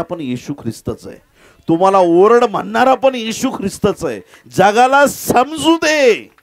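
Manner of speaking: loud, high, impassioned sermon climax; full-force preaching. Real, not acted. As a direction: build to a ringing shout for the whole world. (जोशपूर्ण, चढ्या आवाजातील प्रवचन)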